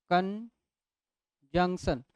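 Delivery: drawn-out कंजंक्शन; slow demo word. Shown as a demonstration. Teacher to class, slowly sounding out a word. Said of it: "इसको कंजंक्शन नहीं पढ़ना है".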